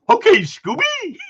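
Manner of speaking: high, slow, lightly put-on Shaggy voice; Shaggy impression. Joking about the dog, a light mock Shaggy voice. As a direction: drawl it out as a goofy Shaggy. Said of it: "It's like freaking Scooby-Doo".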